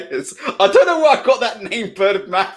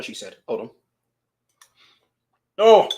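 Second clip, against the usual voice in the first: ordinary talk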